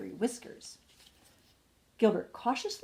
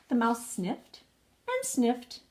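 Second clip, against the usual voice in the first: plain reading voice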